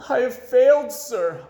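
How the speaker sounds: a high, loud broken sob; sobbing. A narrator's reading. Boy reports failure to the chief, sobbing. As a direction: choke it out in tears, loudly. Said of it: "I sobbed".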